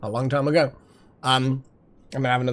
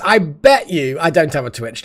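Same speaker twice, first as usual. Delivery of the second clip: ordinary talk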